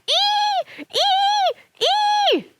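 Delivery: very high repeated shrieks; shrieking. Acted. Sister chasing after her, high shrieks. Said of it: "and her sister shrieked".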